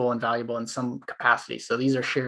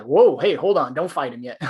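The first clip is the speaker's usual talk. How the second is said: urgent call to hold off; 6 dB louder than usual. Retold or imitated. Holding back a teammate from fighting.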